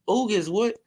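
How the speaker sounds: what are you even on about; calling out the boxer in disbelief; incredulous. Real, not acted. Incredulous callout.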